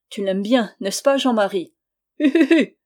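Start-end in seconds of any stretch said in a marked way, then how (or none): 2.2-2.8 put-on high, loud childish hoot of laughter. A narrator's reading. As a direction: giggle like a delighted child. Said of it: "eut un rire puéril". (rire puéril, aigu et fort, hu hu hu)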